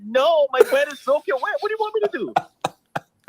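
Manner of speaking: high-pitched, incredulous protest; retold exasperated protest. Caller replaying his refusal to his date, exasperated protest. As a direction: act out refusing, pitch climbing sharply into the question. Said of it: "And I'm like".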